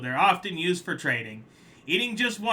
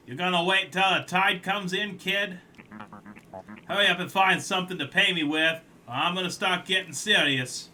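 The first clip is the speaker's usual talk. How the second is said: put-on a lightly done New York tough-guy accent; 5 semitones higher, 3 dB louder than usual. Collector pressuring the crab, light New York accent. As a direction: hurry him up in a rough New York accent. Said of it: "that's my best New York accent".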